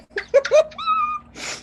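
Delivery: high squealing laugh through the nose; choking laugh. Real, not acted. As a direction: laugh as wine goes up your nose. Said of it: "it's coming up through my nose".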